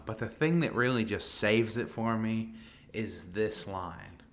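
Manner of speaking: ordinary talk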